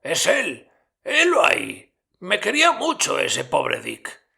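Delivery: mucho más agudo y fuerte, ilusionado; Scrooge emocionado. A narrator's reading. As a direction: reconoce su pasado con entusiasmo. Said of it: "dijo Scrooge al espíritu". (Scrooge ante el almacén, agudo y emocionado)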